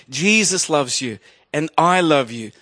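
ordinary talk, nothing marked

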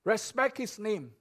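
ordinary talk

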